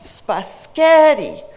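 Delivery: SPAGHETTI as spuh-SKET-ee, middle long, loud and high; slow demo of "spaghetti" with an extra K; garbled middle-stressed word. Shown as a demonstration. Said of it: "the center syllable was pronounced".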